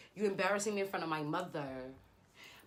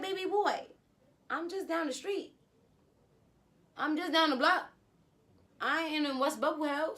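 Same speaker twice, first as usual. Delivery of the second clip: sassy; 7 semitones higher than usual. Retold or imitated. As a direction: put him in his place, pitched high. Sassy retort to tracking ex.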